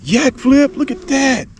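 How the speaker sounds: high, loud, thrilled whoops; hollering over a huge catch; excited shout. Real, not acted. Catching a big crappie.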